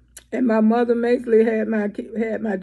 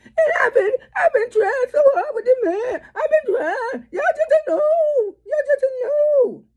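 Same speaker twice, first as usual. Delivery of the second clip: very high, wailing hammed-up sob; mock the tearful mother with an overdone wail; exaggerated sobbing parody, pitched way up; mock crying; 12 semitones higher than usual. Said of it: "I don't cry like that".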